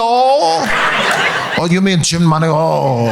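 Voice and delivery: put-on slow, drawn-out Korean-accented correction; mock Korean correction. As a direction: correct it with a stretched Korean lilt. Mimics her correcting the pronunciation.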